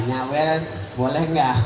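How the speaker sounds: ordinary talk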